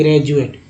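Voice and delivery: ग्रेजुएट with a J, "gra-ju-ate"; J sound. Shown as a demonstration. Teacher shows the correct reading, J-sound pronunciation. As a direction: say ग्रेजुएट with ज in place of D. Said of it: "फिर ज लगाएंगे".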